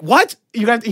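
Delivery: high, loud disbelieving "What?"; mock shock at the request; incredulous. Disbelieving retold reaction.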